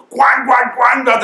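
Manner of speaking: put-on high excited child voice; child tugging at grandad on waking; little grandson. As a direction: imitate an eager little boy calling grandad. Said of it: "he goes".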